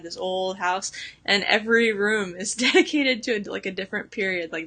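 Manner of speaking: usual speaking voice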